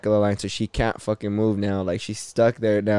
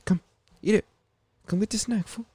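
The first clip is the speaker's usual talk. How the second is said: squeaky high coaxing voice for the dog; high pet-talk voice; 11 semitones higher, 4 dB quieter than usual. Real, not acted. To his dog Ollie, offering a snack, high baby-talk coaxing.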